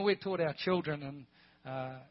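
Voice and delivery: ordinary talk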